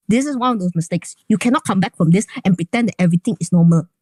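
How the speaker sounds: firm and loud, punching cannot; stern, firm. Real, not acted. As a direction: lay down the law firmly. Stern lecture on consequences.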